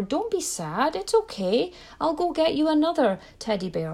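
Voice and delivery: high, anxious rush to soothe; mock-panicky parent hushing a sad child; fretful placating parent. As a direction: fix it fast, pitched well up. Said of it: "run scared and go into fear".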